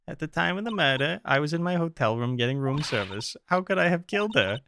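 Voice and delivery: witness protesting innocence. Acted. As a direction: plead an alibi in a girly voice. Voices witness April May.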